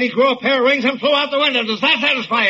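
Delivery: high-pitched exasperated outburst; sarcastic shouting. Acted. Editor fed up with a hysterical reporter, shouting. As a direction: blow up in mocking surrender. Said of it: "Don't shout at me".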